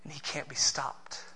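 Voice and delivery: whispered, soft; hushed awe. Acted. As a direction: breathe it out in a whisper. Hushed climax line.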